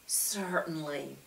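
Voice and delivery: soft assurance. A narrator's reading. Lucia's voice.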